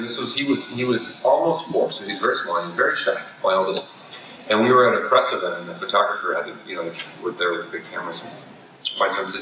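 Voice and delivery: ordinary delivery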